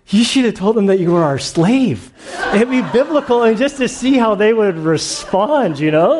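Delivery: amused, laughter breaking into the words; laughing. Real, not acted. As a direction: giggle as you tell the punchline. Joking about slave title.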